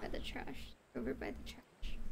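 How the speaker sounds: soft unvoiced whisper. Real, not acted. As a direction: whisper the location. Whispered repeat.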